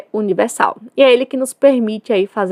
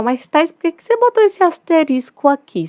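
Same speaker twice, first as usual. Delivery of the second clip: student voice; 7 semitones higher than usual. Pretend. Teacher voices a student's question.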